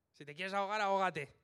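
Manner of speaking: loud fed-up snap, ahógate punched hardest; exasperated outburst. Retold or imitated. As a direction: throw it away at the ant, loudly fed up. Retold exasperated remark to an ant. (estallido exasperado y fuerte)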